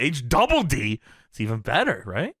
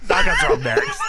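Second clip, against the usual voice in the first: way higher, squealed through laughter; laughing mock announcer; 4 dB louder than usual. Host parodying the interviewer's segue, laughing high squeal. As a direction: shriek the promo line while cracking up. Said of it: "repeating the line as a joke".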